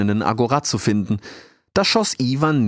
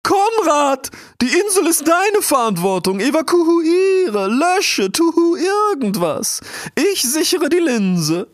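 very high, loud, drawn-out owl cries; commanding owl; 4 dB louder, slower than usual. A narrator's reading. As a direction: hoot urgent orders, stretching the vowels. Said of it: "wie er kurz zuvor die Eule vernommen hatte". (schrill rufende Eule, gedehnte Befehle)

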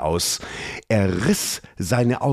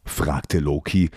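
plain reading voice

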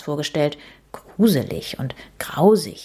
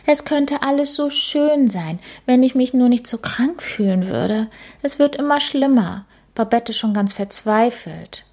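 higher ghost voice, sad and weary; tired ghost lady; 6 semitones higher than usual. A narrator's reading. Ghost telling Käthe she feels ill, weary and high. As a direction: sound listless and worn out.

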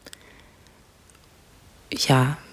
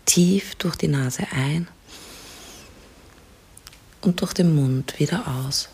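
soft spa whisper; 5 dB quieter than usual. Acted. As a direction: whisper calmly, guide them to relax. Leads a guided relaxation.

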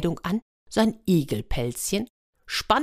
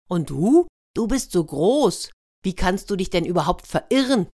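cheeky retort; 3 semitones higher, 4 dB louder than usual. Acted. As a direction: throw the taunt back, louder on the opening. Hedgehog throws the question back.

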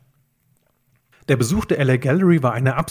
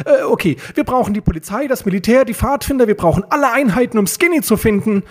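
high, panicky outburst; worked up, pitch way up; frantic calling; 9 semitones higher, 4 dB louder than usual. A narrator's reading. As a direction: rattle off demands in alarm. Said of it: "rief Peter".

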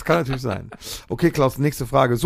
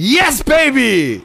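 triumphant cheer; 8 semitones higher, 5 dB louder, much slower than usual. Real, not acted. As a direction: celebrate like you just scored. Cheering after right answer.

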